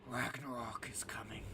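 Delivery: menacing threat. Acted. Lokis threatening the dreamer in unison, menacing whisper. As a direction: whisper it as a dark warning. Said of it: "rather attempt to further their threat".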